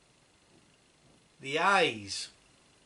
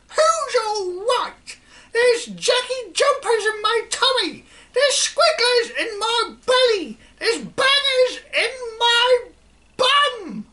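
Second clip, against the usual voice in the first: put-on wailing old woman; 7 dB louder than usual. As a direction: howl about the tummy pains. Grandma wails about stomach pains.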